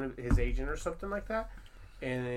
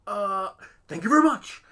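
a touch of a halting Nicolas Cage, louder; stammering, starstruck-back Nick Cage thanks; lightly voiced Nick Cage; Nicolas Cage impression; 6 semitones higher, 8 dB louder than usual. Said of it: "he's being Nicolas Cage as Nicolas Cage can be".

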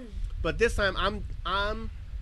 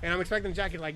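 ordinary talk

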